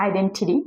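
identity as "identidy"; flapped last t. Shown as a demonstration. Teacher correcting to the native form, identity ending in "-dy". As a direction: soften the final t of identity into d. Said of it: "ঠ সাউন্ডকে ওরা ড উচ্চারণ করবে".

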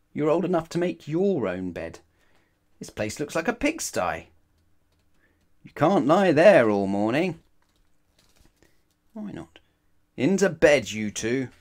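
loud, high exasperated parent; scolding parent. Acted. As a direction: nag like a fed-up parent. Parents' sayings acted out.

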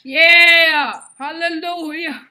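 loud triumphant whoop, slow on Hallelujah; loud victory cheer called not ladylike; unladylike whoop. Real, not acted. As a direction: cheer it out with no manners. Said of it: "That, that is not ladylike".

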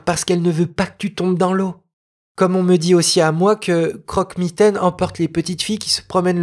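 put-on a spoiled girl explaining away fairy tales; know-it-all girl. Noémie telling the boy tales are for babies, lightly superior girl voice.

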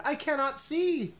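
high, loud, lost and alarmed; a high frightened cry; panicked cry. A narrator's reading. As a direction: Toad cries out, blinded by ice cream. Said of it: "cried Toad".

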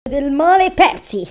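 loud incantation. Acted. Casting the spell loudly.

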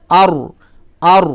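র said thick with rounded lips; heavy rounded r. Shown as a demonstration. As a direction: make র full-mouthed and round. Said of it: "এইরকম করে উচ্চারণ করব".

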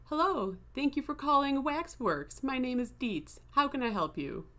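sing-song customer service voice; cheery phone voice. Retold or imitated. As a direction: chirp it like a recorded intro. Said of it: "I had to hear myself go".

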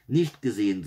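put-on worked-up child; excited. A narrator's reading. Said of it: "sagte Janina aufgeregt".

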